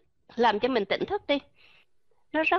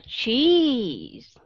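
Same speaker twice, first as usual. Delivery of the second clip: slow drawn-out cheese; 4 dB louder than usual. Shown as a demonstration. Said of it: "teaching word cheese".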